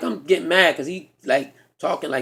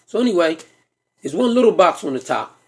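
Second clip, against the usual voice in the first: ordinary talk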